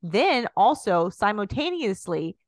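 loud, high huckster sales pitch; salesman pitch. Retold or imitated. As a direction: sell it like a cheesy infomercial. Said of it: "be like".

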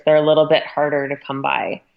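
normal talking voice

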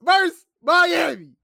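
loud, high, drawn-out hype; excited shout. Real, not acted. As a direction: shout it like a fan. Excited wish for matchup.

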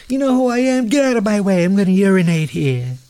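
mock Trump bluster. Mocking Trump urinating in halls.

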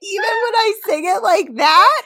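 laughing. Real, not acted. Laughing teasing question.

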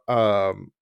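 normal talking voice

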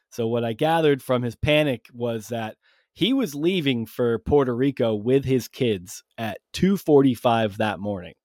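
ordinary delivery

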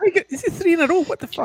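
high-pitched gleeful surprise; excited disbelief. Real, not acted. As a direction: squeal it in delight. Excited at own prediction.

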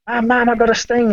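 put-on high, wailing child calling for mum; mock crying call. A stung boy bursts in calling his mum, high and teary. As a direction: whimper it like a stung kid. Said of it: "barged through the door crying".

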